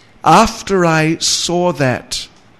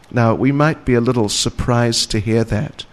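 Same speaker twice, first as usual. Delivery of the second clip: ordinary talk, nothing marked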